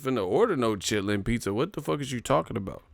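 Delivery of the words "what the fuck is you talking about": ordinary talk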